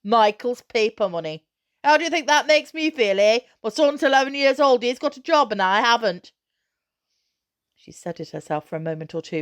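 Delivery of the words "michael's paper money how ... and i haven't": high, loud bitter rant in his voice; mother voicing the father's bitter complaint, loud; father's bitter outburst, quoted. A narrator's reading. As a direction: spit out his shame and anger. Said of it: "he says".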